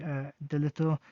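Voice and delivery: ordinary delivery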